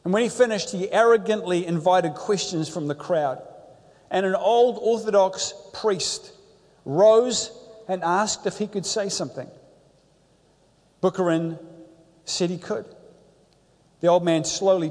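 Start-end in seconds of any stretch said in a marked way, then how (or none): none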